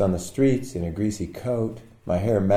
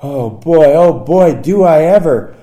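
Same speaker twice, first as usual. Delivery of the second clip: eager thought; 7 semitones higher, 10 dB louder than usual. Retold or imitated.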